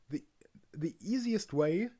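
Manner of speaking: usual speaking voice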